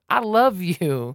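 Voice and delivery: slow, drawn-out mock yell; retold yell. Cyclist yells back at angry driver, slow retold yell. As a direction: imitate yelling it, stretching the words out. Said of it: "I started yelling at him".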